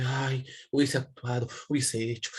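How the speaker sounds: lightly voiced regretter. Teacher imitates someone dwelling on regrets, lightly mock lament. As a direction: lightly act out wishing you had acted.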